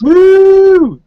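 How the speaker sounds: high, loud woo; excited whoop. Real, not acted. Host whooping at his own joke, loud and high. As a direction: let out a big cheer.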